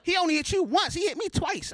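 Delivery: hammed-up excitement with a laugh; mock excited. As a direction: hype it up like a proud loser. Retold wake-up line.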